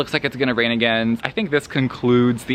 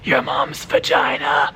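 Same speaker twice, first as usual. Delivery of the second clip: slow breathy whisper of the line; whispered quote; 2 semitones higher, slower than usual. Retold or imitated. Reading a character's crude line to viewers, whispered. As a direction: hush it like a dirty secret. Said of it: "She was like".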